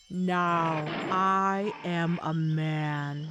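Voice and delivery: very slow, drawn-out tiger voice; tiger posing as man. A narrator's reading. Tiger, now wearing father's clothes, declares himself human, slow beastly voice. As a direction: draw each word out slowly as a beast in disguise.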